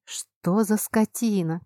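ordinary delivery, nothing marked

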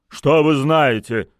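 exasperated. A narrator's reading. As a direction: lose patience and demand an answer. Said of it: "не выдержал Гуд". (не выдержав, громко)

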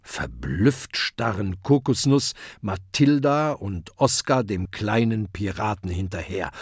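ordinary delivery

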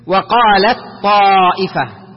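slow "وقالت طائفة", ت silent inside a doubled heavy ط; ت merged into ط. Shown as a demonstration. Teacher models assimilation, ت unpronounced before ط. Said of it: "يصبح حرف الطاء هو المثبت".

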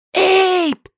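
high, loud frightened call for help; desperate cry. A narrator's reading. Li calling Abe from behind in fright. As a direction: cry out for him in panic. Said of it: "неслись сзади отчаянные вопли".

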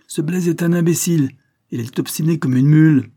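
loud, high contempt for the gardener; angry outburst. A narrator's reading. Sister scorning the gardener to her brother, loudly angry. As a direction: spit out the insult. Miss Carlyle insulting the gardener.

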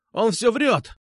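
high, insistent protest; blurting out. A narrator's reading. Marat keeps protesting to teacher, blurting out. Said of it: "затарахтел Марат".

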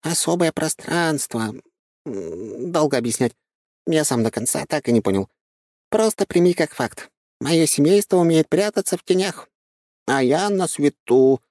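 high, light talking squirrel; chirpy little creature voice. Acted. Squirrel's lines.

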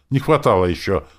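high, loud, huffy protest; indignant. A narrator's reading. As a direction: answer back as if insulted. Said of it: "возмутилась она".

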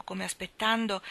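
usual speaking voice

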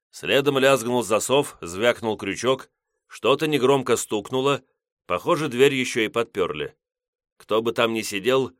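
usual speaking voice

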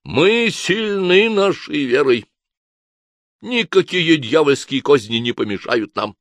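slow, high, loud solemn decree; solemn proclamation. A narrator's reading. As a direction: proclaim it like a sermon. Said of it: "торжественно провозгласил".

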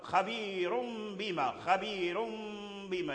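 "خبير بما" as khabeerum bima, M hummed long; tanween iqlab demo. Shown as a demonstration. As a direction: slowly turn the tanween of "خبير" into a held M. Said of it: "النون ساكنة".

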